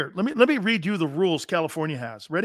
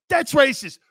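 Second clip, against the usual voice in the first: mock outrage; 8 semitones higher, 7 dB louder than usual.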